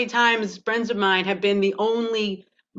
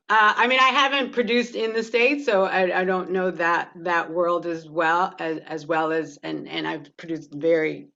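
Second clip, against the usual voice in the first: ordinary delivery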